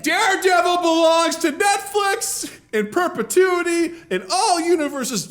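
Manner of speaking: high, indignant studio exec; mock heated negotiator. Studio exec claiming Daredevil in negotiations, mock indignant. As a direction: stake the claim in a high, insistent voice.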